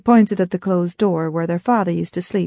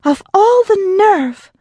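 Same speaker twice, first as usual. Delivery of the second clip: much higher, louder, slow outraged cry; ranting; 12 semitones higher, 5 dB louder, slower than usual. Acted. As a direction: explode with outrage, drawing it out. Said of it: "Grace ranted".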